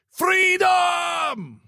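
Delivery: put-on Scottish accent, shouted high and long; Scottish battle cry. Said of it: "in Scottish accent".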